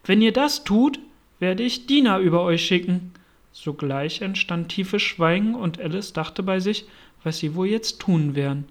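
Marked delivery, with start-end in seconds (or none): 0.0-3.1 louder, forceful acted shout; shouted threat. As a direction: raise the voice to threaten them. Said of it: "schrie Alice so laut sie konnte".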